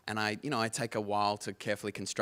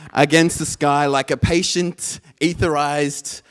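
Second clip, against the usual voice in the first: slammy beat poetry; 4 semitones higher, 11 dB louder than usual. Shown as a demonstration. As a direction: punch each phrase like a slam poet. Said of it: "really slammy kind of beat poetry style".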